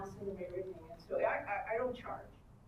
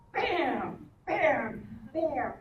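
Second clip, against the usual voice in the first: loud, higher mock bangs on the door; pounding knock sound; 5 semitones higher, 9 dB louder, much slower than usual. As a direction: bang out each bam like a fist. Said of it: "I just".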